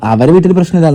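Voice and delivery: ordinary delivery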